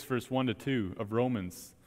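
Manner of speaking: ordinary delivery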